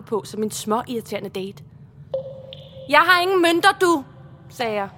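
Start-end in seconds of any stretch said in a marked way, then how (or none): 2.9-4.0 højt og i hævet toneleje; trodsigt svar. Acted. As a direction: fyr afvisningen af for fuld styrke. Trodsigt svar til færgemanden. (højlydt og trodsigt)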